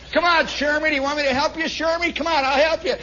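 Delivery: high-pitched taunting offer; mock helpfulness. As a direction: egg him on while pretending to help. Tormentors mockingly offer help, acted.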